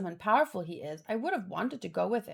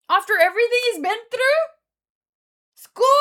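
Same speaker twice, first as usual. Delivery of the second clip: fast, much louder and higher indignant yell; outraged shout; 12 semitones higher, 10 dB louder, faster than usual. Real, not acted. Reader furious that the hero must go back to school, outraged shout. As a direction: explode at the injustice of it.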